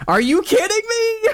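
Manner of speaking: high, loud furious scream; real yell. Real, not acted. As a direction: scream it out in disbelief. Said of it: "didn't mean to yell".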